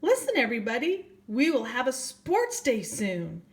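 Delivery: rally the little pupils like a teacher owl; announcing owl teacher. Acted. Ernest Owl addresses his school.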